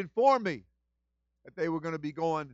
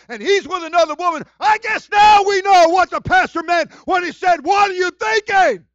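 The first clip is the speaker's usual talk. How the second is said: shouted, much higher and louder sermon climb; full-force preaching; 14 semitones higher, 17 dB louder than usual. Real, not acted. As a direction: preach it out at the top of your voice.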